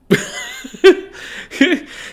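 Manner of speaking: high, breathless laugh; burst of laughter. Real, not acted. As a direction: break out laughing at the screen. Watching knockout fight, laughing.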